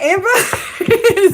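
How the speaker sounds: high amused laugh through the name; calling her name while cracking up; viewer's name laughed out in surprise. Real, not acted. Amused at a stranger naming Amber.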